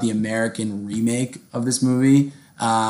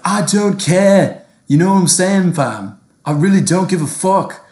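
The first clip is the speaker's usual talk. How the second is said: British street accent; 7 semitones higher, 6 dB louder than usual. Shown as a demonstration. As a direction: put on an exaggerated London "fam" accent. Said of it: "a little taste of a British accent".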